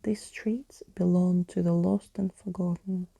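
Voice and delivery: ordinary delivery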